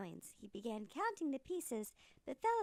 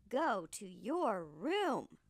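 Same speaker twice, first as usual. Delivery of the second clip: slow, stern, louder order; angry mother; 3 semitones lower, 5 dB louder, much slower than usual. Acted. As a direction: send him off firmly, each word weighed. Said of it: "She was very angry with Jack".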